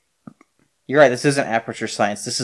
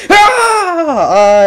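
high laughing yelp; 8 dB louder than usual. Real, not acted.

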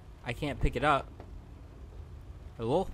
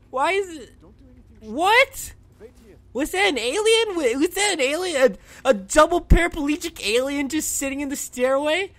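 high, loud startled exclaiming; shocked disbelief; 11 semitones higher, 6 dB louder than usual. Real, not acted. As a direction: cry out in alarmed amazement. Reacting to a creature in game.

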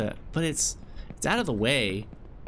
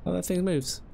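ordinary talk, nothing marked

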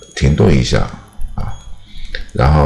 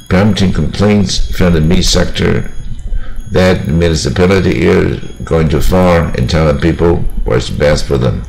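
a bit faster. Shown as a demonstration. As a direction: run BUSES, SHELTERS, SCREENS closely together. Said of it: "这边念快一点".

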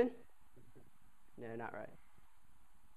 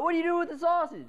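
demanding upperclassman; 11 semitones higher, 6 dB louder, faster than usual. Retold or imitated. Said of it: "it was like".

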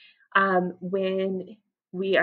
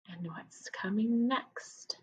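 slow whispered question; suspenseful whisper; 7 dB quieter, much slower than usual. Real, not acted. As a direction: hush the class before the next page. Teacher builds suspense for kids.

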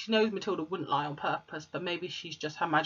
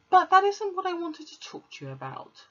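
put-on higher little girl voice, pleading; Matilda; 12 semitones higher, 4 dB louder than usual. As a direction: plead your innocence as a child.

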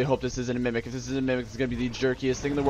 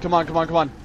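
rapid-fire, raised repeated urging; urgent pleading; 4 semitones higher, 4 dB louder, much faster than usual. Real, not acted. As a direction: frantically urge it along. Urgently fleeing a pursuer in game.

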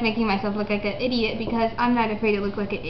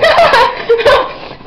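shrieking laugh, much higher and louder; 10 dB louder than usual. Real, not acted. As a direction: burst out laughing loudly. Spits out marshmallows, laughing.

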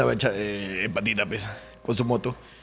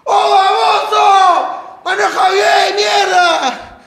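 drawn-out shout, higher and slow; yelling; 7 semitones higher, much slower than usual. Retold or imitated. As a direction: yell it out the window. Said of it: "me grita".

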